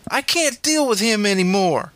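high, fed-up wail; exasperated shriek. A narrator's reading. Stepmother to dad about his son, shrieking.

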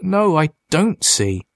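slow, high-pitched, tearful refusal; anguished and slow; tearful protest. A narrator's reading. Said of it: "tears of emotion coursed down his cheeks".

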